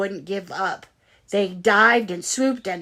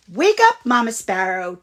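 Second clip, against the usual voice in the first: chirped; 3 semitones higher, 8 dB louder than usual. A narrator's reading. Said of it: "chirped the next morning".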